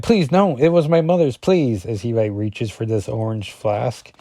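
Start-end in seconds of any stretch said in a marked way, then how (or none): 0.0-1.8 higher, louder put-on begging; acted pleading. Husk begs as he reaches for her flask, acted pleading.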